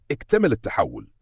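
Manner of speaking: ordinary delivery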